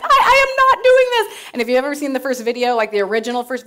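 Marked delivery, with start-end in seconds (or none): 0.0-1.3 high, loud panicked no; horrified refusal. Retold or imitated. She panics at her sister, high and loud. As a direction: refuse in horror. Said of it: "I'm like".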